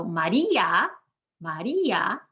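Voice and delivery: "Maria" in three syllables, ma-REE-a, I stressed; strong I. Shown as a demonstration. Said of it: "we've made the I strong".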